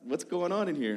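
visiting pastor telling the intern why he came, mock cheerful; pastor's reply. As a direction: mimic the pastor's cheerful answer. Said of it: "pastor's reply".

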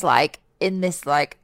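ordinary talk

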